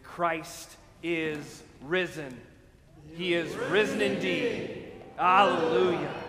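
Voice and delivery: slow proclaimed call, then loud Hallelujah; Easter acclamation. Real, not acted. Pastor leading the Easter greeting, proclaiming. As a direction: proclaim it to the congregation.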